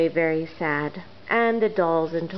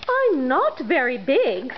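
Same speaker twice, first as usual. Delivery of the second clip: higher, hesitant little engine; unsure of herself; modest little engine; 6 semitones higher than usual. Acted. Blue engine telling toys she is small, hesitant.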